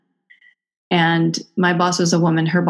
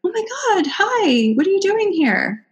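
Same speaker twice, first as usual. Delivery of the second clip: much higher gushing greeting; excited studio owners; 14 semitones higher than usual. Retold or imitated. Studio owners greeting her, a high gushing mock voice. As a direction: gush it out like delighted owners. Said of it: "They were like".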